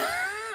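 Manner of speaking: high, quiet giggle; real laugh. Real, not acted. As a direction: giggle at your own slip. Laughs at own name slip.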